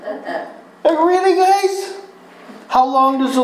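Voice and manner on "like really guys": incredulous. Real, not acted. Preacher mocks disciples' disbelief.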